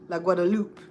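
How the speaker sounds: "La Guadalupe" ending -lup, no final e; French La Guadalupe. Shown as a demonstration. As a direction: clip the end of "Guadalupe". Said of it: "It's French".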